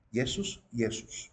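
"Jesús" as Yesús, softly; Greek and Latin pronunciation. Shown as a demonstration. Teacher showing both languages say it alike, Yesús pronunciation. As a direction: say each "Jesús" with a Y sound. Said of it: "en griego y en latín".

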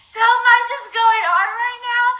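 high and loud, overwhelmed; frazzled excitement. Real, not acted. Vlogger reeling after a chaotic live start, high and loud. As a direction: blurt it out, overwhelmed and buzzing.

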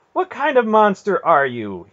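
high, loud, tight anger; through clenched teeth. A narrator's reading. Garion accusing his aunt, clenched-teeth anger. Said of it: "he asked from between clenched teeth".

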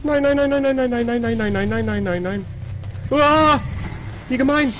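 flee in real panic; panicked shouting. Real, not acted. Chased and killed.

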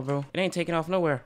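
normal talking voice

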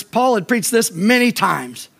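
ordinary talk, nothing marked

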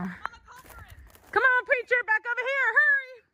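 high, rising call to the dog; eager call. Real, not acted. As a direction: call the dog back, urging. Calling dog over eagerly.

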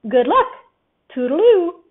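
cheery call. A narrator's reading. Owl waving off the rescue party, cheerful call. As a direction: Owl calling goodbye cheerily. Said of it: "he called cheerily".

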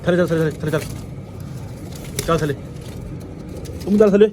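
loud high shouts driving birds out; shooing loudly. Real, not acted. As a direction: chase them out with shouts. (ਉੱਚੀ ਆਵਾਜ਼ 'ਚ ਭਜਾਉਣਾ)

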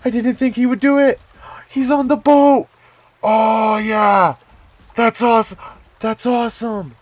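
loud, high-pitched thrilled shouting; elated, breaking into a yell; freaking out. Real, not acted. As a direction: shout it out in overjoyed disbelief. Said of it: "I'm just freaking out right now".

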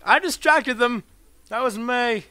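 high, loud complaint; indignant protest. Real, not acted. Player claiming a game kill, high loud protest. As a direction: protest loudly that it belongs to you.

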